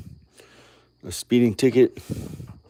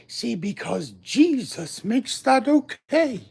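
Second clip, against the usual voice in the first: slower preacher; 9 semitones higher, 3 dB louder, slower than usual. Retold or imitated. Slower mock-preacher repeat.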